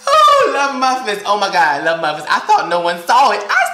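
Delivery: mortified laughing fit; laughing hysterically. Real, not acted. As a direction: laugh until you can barely talk. Laughing at being caught.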